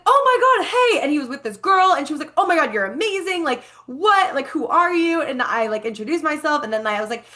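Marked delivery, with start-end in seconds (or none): 0.0-1.0 high, loud mock squeal of recognition; excited greeting. Imitating a guy who spots her at the festival, excited greeting. Acts out guy's delighted greeting. 2.4-5.2 high, gushing imitation of an admirer; amazed gushing. Imitating the girl she has just met, amazed gushing. Acts out girl's amazed reaction.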